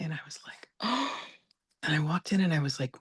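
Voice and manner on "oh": high, soft gasped "oh"; mock-horrified little gasp; shocked gasp. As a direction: re-enact a sudden intake of breath in shock. Overhears mother's remark, reacts shocked.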